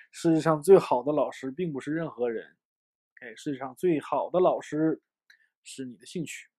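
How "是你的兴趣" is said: ordinary talk, nothing marked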